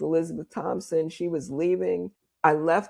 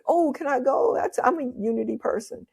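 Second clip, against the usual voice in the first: excited; 8 semitones higher than usual. Retold or imitated. Telling the stranger she wants to come along, excited. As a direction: jump at the invite with eagerness. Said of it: "So I got all excited".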